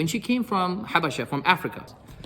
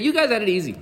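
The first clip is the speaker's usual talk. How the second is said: raised, louder mock taunt; retold taunt; 7 semitones higher, 4 dB louder than usual. Umar to those back from Habasha, retold taunt. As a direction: throw it out as a teasing jab. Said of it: "he's taunting her".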